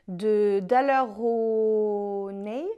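Daler-Rowney said slowly with a heavy French accent, syllables drawn out; French accent on English name. Shown as a demonstration. Vlogger unsure how to say the brand, slow French-accented attempt. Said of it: "mon accent magnifique en anglais".

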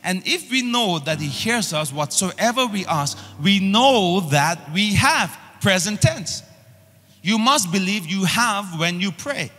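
ordinary talk, nothing marked

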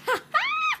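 short high giggle; a quick squeaky burst of laughter; real gleeful giggle. Real, not acted. Laughs at enemy's defeat.

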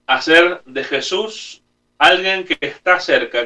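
ordinary talk, nothing marked

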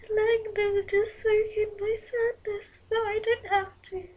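breaking into loud sobbing; crying out. Real, not acted. Alone in her room, wishing staff would hug her, sobbing. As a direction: voice cracking high and loud as she cries. Imagining being hugged in sadness.

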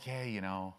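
low, quiet, listless mumbling testimony; mock half-hearted witness. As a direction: imitate someone witnessing with no freshness at all. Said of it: "and you're just like".

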